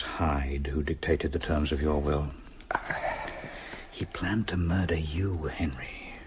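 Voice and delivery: whispered, slow and urgent; a breathless whisper of alarm; hushed warning. Acted. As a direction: warn him under your breath. Urgent warning about Hyde.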